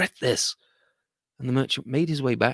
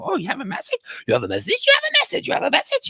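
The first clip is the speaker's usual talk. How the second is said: high parrot chatter, repeating; a flock of parrots echoing each other; high squawky parrots; parrot voices; faster than usual. Acted.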